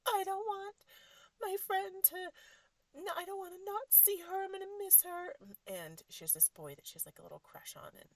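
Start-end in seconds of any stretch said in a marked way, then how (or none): 0.1-5.4 put-on high, loud child's wail; mock tearful whine, pitched way up; imitated crying. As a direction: mimic a kid wailing through tears. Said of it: "crying and crying".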